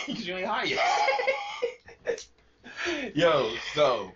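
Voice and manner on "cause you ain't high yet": slow laughing tease. Real, not acted. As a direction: drag it out laughing. Teasing friend.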